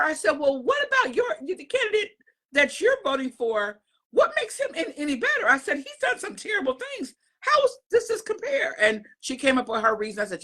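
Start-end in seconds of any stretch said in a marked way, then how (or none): 0.3-8.7 high, cracking voice of disbelief; exasperated. Retold or imitated. Retelling arguing with a friend about her candidate, incredulous squeak. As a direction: pitch shooting up in outraged disbelief. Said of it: "So then I said to her".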